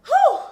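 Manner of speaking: release breath. Real, not acted. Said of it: "so tired of holding it in".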